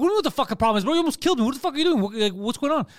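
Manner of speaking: high, agitated retold demand; worked-up outrage in a retelling; indignant confrontation. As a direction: fire questions at the driver, reenacting.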